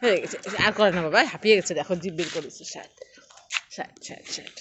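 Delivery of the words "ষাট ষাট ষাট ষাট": hushed voiceless comfort; soft breathy hush to settle the pet; whispered soothing. Real, not acted. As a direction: whisper to calm a panting pet. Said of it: "soothing whisper to pet".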